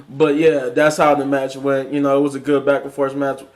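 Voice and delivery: normal talking voice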